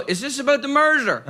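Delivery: high, anxious blurting with a laugh; the driver who gives himself away; flustered guilty driver. Pretend. Imagined guilty driver answering cop.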